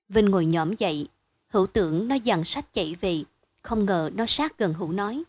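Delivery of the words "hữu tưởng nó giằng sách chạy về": ordinary delivery, nothing marked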